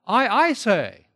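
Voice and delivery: doubled I, high and loud; emphatic I. Shown as a demonstration. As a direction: hit both I's hard and slow. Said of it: "It's an emphatic".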